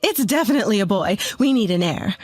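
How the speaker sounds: quick, insistent mother-in-law; interjecting. A narrator's reading. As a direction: cut in fast and certain. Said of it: "when she interjected".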